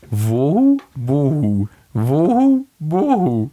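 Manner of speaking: slow rising wohu, falling bohu, pitch leaping up; owl hoot on request. Shown as a demonstration. Cohost asked to copy owl call, rising hoot. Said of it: "[name], jetzt bist du dran".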